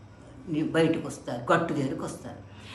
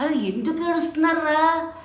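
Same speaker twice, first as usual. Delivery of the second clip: put-on much higher and a bit louder, asking the boys; a master baffled by the weeping pupils; puzzled guru; 10 semitones higher, 5 dB louder than usual. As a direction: guru questions his crying disciples. Guru asks again.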